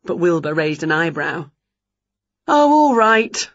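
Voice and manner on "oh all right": high, slow, loud giving-in; exasperated drawn-out concession; grudging. A narrator's reading. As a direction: give in grudgingly. Winnie gives in to Wilbur.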